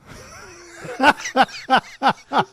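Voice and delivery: high, loud burst of laughter. Real, not acted. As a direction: crack up in pulsing bursts. Laughs at the accent bit.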